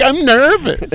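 high, pathetic whimper; whiny nervous voice. Retold or imitated. The guy at the stag do tells paramedics, high and whiny. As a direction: sound like a feeble Westerner. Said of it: "being like".

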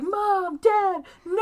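high, loud theatrical wail; hammed-up horrified scream for parents; mock anguished cry. Son seeing parents killed, retold.

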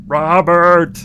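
drawn-out, urgent sitcom brother voice; mock stage whisper that stays voiced; Raymond. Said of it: "he whispers".

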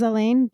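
"Alain" said uh-LANE; weak start, stressed "lane" ending on "Alain"; second-syllable stress. Shown as a demonstration. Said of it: "I would probably read it as".

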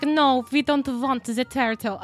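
mock French accent, loud and high; a clumsy fake French voice; hammed-up French accent, badly done. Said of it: "that was not a French accent".

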